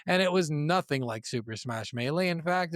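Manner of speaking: normal talking voice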